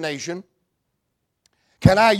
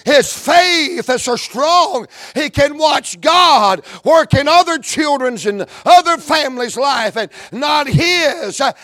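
loud, higher preacher's cadence; preaching build; 4 semitones higher, 4 dB louder than usual. Acted. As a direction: climb toward the verse with force. Preaching at full force.

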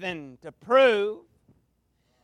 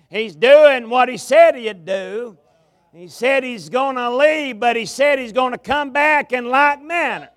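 louder, driving preacher's cadence with rising pitch; full-force preaching; 2 semitones higher, 6 dB louder than usual. Acted. Revival preacher to his congregation, preaching at full force. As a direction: preach it hard to the tabernacle crowd.

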